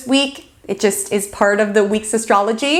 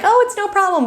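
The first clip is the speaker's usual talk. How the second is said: bright, much higher, sugary 'no problem' that hides anger; fake sweetness; 10 semitones higher than usual. Telling a friend it's fine while secretly stewing, fake sweet. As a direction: sound sweetly accommodating while seething inside. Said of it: "we could be a little too sweet".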